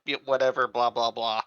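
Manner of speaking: ordinary delivery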